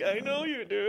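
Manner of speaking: high, teasing, knowing reply; smug flirt. Retold or imitated. Acted flirty reply to fan.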